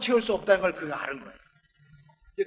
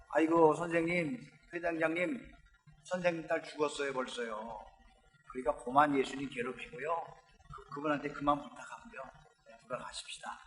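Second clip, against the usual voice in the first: low, much softer, fading to a whisper; hushed bad news; 6 semitones lower, 10 dB quieter than usual. Messengers telling the father his daughter died, hushed and fading. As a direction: break the news gently, sinking away.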